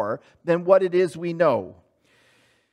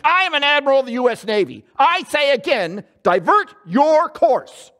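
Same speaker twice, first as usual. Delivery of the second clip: loud, fast, high admiral barking orders; furious commanding yell into the mic; angry yell; 7 semitones higher, 4 dB louder than usual. Retold or imitated. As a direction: shout it like an outraged admiral. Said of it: "yelled into the mic".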